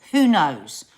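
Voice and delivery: normal talking voice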